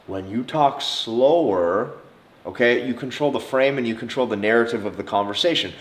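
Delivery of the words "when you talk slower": slow, drawn-out delivery; talking slower. Shown as a demonstration. Demonstrating slow talk to men, very slow. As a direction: stretch every word. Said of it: "talking slower".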